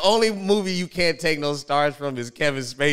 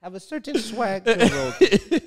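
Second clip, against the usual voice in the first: high, laughing put-on pundit voice; a hammed-up expert holding forth; mock pundit voice; 11 semitones higher than usual. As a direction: play a pompous know-it-all explaining. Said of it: "He said".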